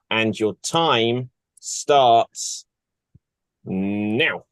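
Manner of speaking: slow, low, suspenseful countdown delivery; starting the clock. Acted. Host starts the player's timer, drawn-out and low. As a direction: hold out each word before NOW.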